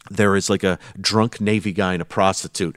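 normal talking voice

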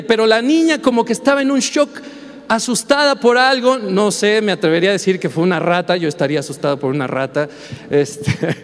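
normal talking voice

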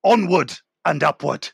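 halting, suspenseful audiobook narration; dramatic story reading. Acted. Author reading his thriller aloud to the host, hammed-up suspense. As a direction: read it out portentously, with long dramatic pauses. Reads a chapter from his book.

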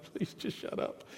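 mock whispered plea; hushed, voiceless mock begging; whispered aside. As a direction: whisper it as the audience's secret thought. Voicing the congregation's silent thought.

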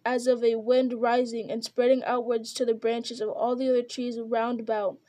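plain reading voice

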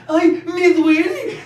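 very high, slow squeaky whine; squeaky little voice. Retold or imitated. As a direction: squeal it like a stuck kid. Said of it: "la vocecita de pito".

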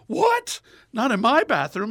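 loud, high outburst of mock disbelief; shocked nun. Nun reading the written word, shocked high outburst. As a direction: imitate an outraged sister, high and loud.

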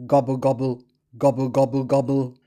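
low, bubbling turkey call; turkey gobble. Shown as a demonstration. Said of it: "Turkey says".

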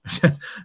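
a quick breathy laugh mid-sentence; short laugh. Real, not acted. Amazed remark about second chances.

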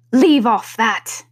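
slow, loud queenly command; screamed order. A narrator's reading. Queen stopping the gardeners bowing, a screamed order. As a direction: drag out a loud, cross order. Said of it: "screamed the queen".

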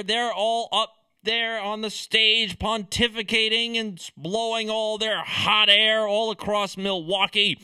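ordinary talk

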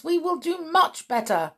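high, quick obedient crowd voice; murmuring audience. A narrator's reading. Audience promises their leader, high quick murmur. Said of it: "murmured the audience".

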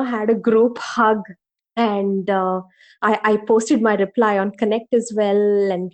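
ordinary talk

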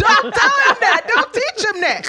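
high loud protest through laughter; laughing scold. Retold or imitated. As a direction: scold him but crack up. Mom scolding teenager while laughing.

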